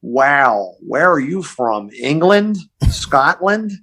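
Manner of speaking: slow, drawn-out California drawl; spaced-out California accent; laid-back California accent. Retold or imitated. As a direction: imitate a mellow Californian. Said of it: "that laid-back California accent".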